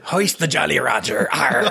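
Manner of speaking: slow, hammed-up pirate growl. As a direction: play a cartoon pirate. Pirate joke on Roger's name.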